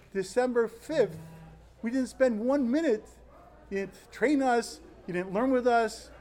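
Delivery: a respectful student venting his frustration at the rabbi; respectful but angry. Retold or imitated. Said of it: "I said it respectfully, angrily".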